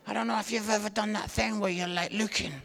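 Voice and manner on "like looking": ordinary talk, nothing marked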